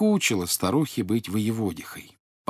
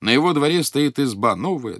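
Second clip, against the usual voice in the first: usual speaking voice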